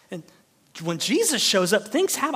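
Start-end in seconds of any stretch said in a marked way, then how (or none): none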